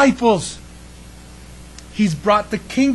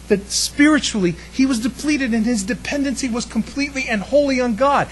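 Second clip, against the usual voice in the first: ordinary delivery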